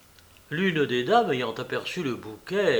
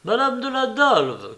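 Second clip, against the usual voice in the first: high, loud call announcing a guest; announcing cry; 9 semitones higher, 5 dB louder than usual. A narrator's reading. Maid announcing a visitor to her mistress, crying out. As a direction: call the name out over the room. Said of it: "cria la bonne".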